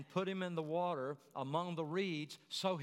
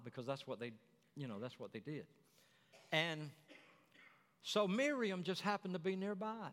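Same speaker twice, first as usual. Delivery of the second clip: normal talking voice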